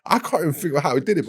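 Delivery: breathless laughing disbelief. Real, not acted. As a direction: laugh your way through the words. Laughing while retelling brawl.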